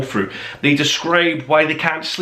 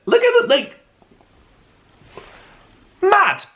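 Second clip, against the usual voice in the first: much higher, thrilled at the page; excited. Real, not acted. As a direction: gush over the page like a fan. Showing off the Russian pages.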